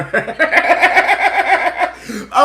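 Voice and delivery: loud, high laughing fit; high burst of laughter. Real, not acted. Streamer cracking up at her own story, high loud laugh. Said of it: "a little laughter".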